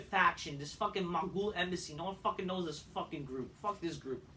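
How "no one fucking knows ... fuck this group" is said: usual speaking voice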